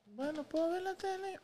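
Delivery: tiny high-pitched mock kid voice; exaggerated falsetto pleading; changed teen voice. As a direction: imitate a kid meekly asking dad for a favour. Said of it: "les cambia la voz a los adolescentes".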